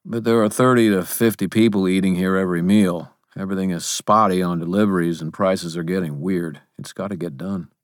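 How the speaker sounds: lightly voiced man, brisk and practical; a quick, lightly acted explanation; hint of a hurried character voice; busy Sir William. A narrator's reading.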